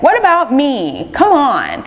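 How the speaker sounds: high, whiny mock complaint; mock self-pity. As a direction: play up feeling sorry for yourself, pitched high. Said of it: "very easily twisted into self-pity".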